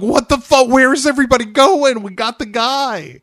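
high, loud, fast disbelief; mock frantic outrage. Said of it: "he's losing his goddamn mind".

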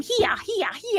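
high chirped creature syllables; animal speak. Acted. Neb showing Feruza how to tell them yes, animal speak. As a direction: demonstrate the critter call, pitched well up. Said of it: "trying to speak as the animal".

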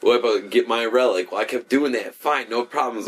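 usual speaking voice

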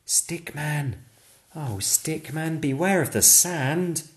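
high, sing-song warning call. Acted. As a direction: chant it like a friendly warning. Chanted warning refrain.

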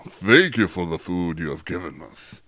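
hammed-up preacher-style grace; a hint of pulpit grandeur in a mock prayer; theatrical prayer. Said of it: "You don't have to get theatrical about praying".

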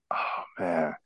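sinking realization you're caught; hushed, defeated sigh of words; dismayed. Retold or imitated. Caught by the teacher.

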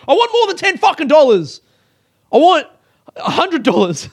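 high, loud outburst; mock furious shouting at customer service; exaggerated angry demand. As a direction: yell it as an over-the-top demand. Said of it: "I didn't say that".

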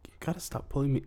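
whispered complaint; whispering. Acted. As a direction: whisper it off to the side. Private aside bit.

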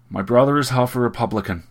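sudden indignant protest; outburst. A narrator's reading. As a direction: burst out with it, exasperated. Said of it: "Gregory burst out".

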